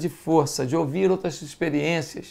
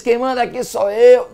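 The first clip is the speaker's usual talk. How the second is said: louder, domineering parent voice; a strict parent laying down the law, louder; bossy strict parent; 5 semitones higher, 7 dB louder than usual. Retold or imitated. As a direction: bark it like a tyrant parent. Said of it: "que diz".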